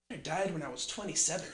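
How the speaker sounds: low, hushed mock regret; mock deflated regret. Dying person looking back on life, mock deflated and hushed. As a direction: sigh it out very softly and low. Imagined deathbed regret after a sigh.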